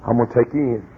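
low, soft reassurance; tender promise. Retold or imitated. God promising Caleb and Joshua the land, soft and low. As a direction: promise gently to bring them in. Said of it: "He said".

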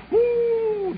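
long, high-pitched whoop; high "whoo" at the cold. Retold or imitated. Boy dipping his toe in icy water, high whoop.

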